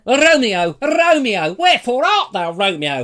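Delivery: high, loud, theatrical swoon of a line; hammed-up Juliet. Juliet calling for Romeo from the balcony, hammed up. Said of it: "Romeo and Juliet".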